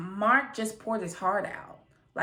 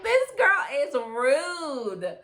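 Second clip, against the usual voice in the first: drawn-out, higher, stunned verdict; slow, stunned judgment; slow disbelief; 7 semitones higher, 4 dB louder, much slower than usual. Retold or imitated. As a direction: stretch every word in disbelief. Reacting to Lindsay's rude answer.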